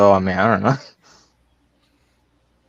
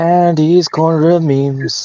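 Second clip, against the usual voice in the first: announcer voice; 7 semitones higher, 3 dB louder, slower than usual. Pretend. Segment announcement.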